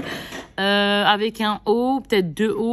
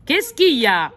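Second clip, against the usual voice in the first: louder, higher call across the house; 3 semitones higher, 5 dB louder than usual. Real, not acted. Calls out to child offscreen.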